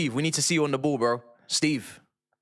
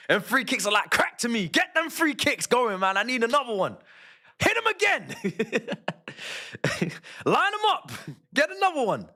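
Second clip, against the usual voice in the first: excited; 13 semitones higher than usual. Real, not acted. Giddy begging for free kicks.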